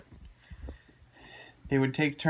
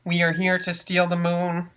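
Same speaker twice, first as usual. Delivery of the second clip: robot voice; 4 semitones higher, 7 dB louder than usual. Acted. Tiny robot speaks.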